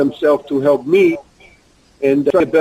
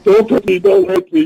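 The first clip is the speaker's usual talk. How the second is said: begging, rising sharply higher and louder; hammed-up plea that climbs higher; mock pleading; 3 dB louder than usual. Retold wrestler begging on his knees, mock pleading rising higher. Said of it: "He get on his knees".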